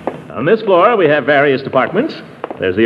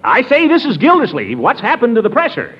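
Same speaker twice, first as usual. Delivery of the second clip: calling down the phone; 10 semitones higher than usual. Real, not acted. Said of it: "Can't hear you".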